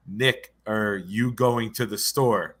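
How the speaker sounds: slow, spaced "Nick, are you going to the store"; slowed-down demonstration. Shown as a demonstration. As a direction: lay out each word slowly so "Nick" and "are" separate. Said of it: "'Cause it sounded like".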